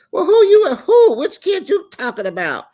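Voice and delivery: shrill prying mock of the crew; nosy producer squeal. Host mocks production prying into her business, squealing high. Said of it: "production all in your business".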